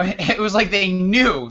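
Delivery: ordinary talk, nothing marked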